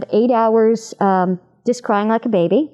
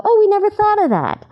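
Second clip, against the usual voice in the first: much higher, delighted exclamation; surprised producers; 12 semitones higher than usual. Retold or imitated. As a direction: mimic producers pleasantly caught off guard. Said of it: "so that they'll go".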